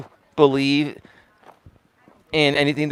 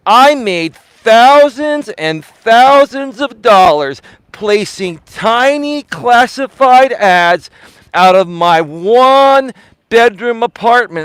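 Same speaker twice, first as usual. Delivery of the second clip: loud, high THOUSANDS, TINY, ONE, stretched out slowly; emphasizing every word; 8 semitones higher, 7 dB louder, much slower than usual. Retold or imitated. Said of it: "like, emphasizing every word".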